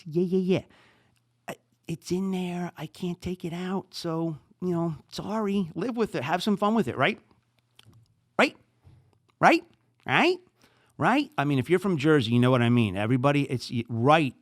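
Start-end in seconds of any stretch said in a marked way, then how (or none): 0.1-0.6 yeah, yeah, yeah high and quick; triple yeah. Shown as a demonstration. Podcaster showing listeners the Jersey triple yeah, quick and high. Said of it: "It's three yeahs". 8.4-11.3 "Right?" tossed up with a rising tune, the last ones higher and louder. Shown as a demonstration. Podcaster demoing his Jersey "right", rising. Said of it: "The word right is all over the place".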